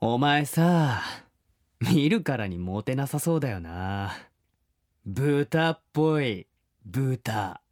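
slow, cold contempt; scornful insult. Pretend. Listener line read as slow cold scorn. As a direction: drawl the insult down at him. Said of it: "安倍長ネーム ... いただきました".

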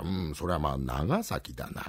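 put-on very slow, low old sage; drawn-out elder pondering; creaky wise old man. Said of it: "白いひげなんざ長く生やしましてね".

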